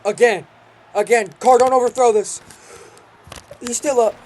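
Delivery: loud excited yelling at the screen; shouting. Real, not acted. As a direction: yell it at the game. Yelling at the game.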